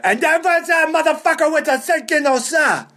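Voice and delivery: put-on high, garbled, accented rant; Pete's unintelligible yelling. Lebanese club owner ranting unintelligibly, imitated garbled yell. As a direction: yell it so the words barely pass for English. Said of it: "may or may not be English".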